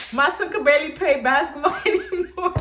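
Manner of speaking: high mock complaint dissolving into laughter; laughing imitation. Storyteller quotes the mother's outrageous claim, high mimicry breaking into laughter. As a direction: mimic her while cracking up. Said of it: "I'm laughing".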